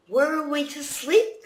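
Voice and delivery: high, wondering question; wondering refugees. Acted. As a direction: ask it high and lost. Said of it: "they wondered".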